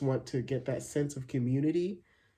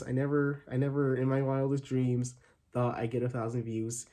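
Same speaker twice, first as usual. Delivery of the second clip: usual speaking voice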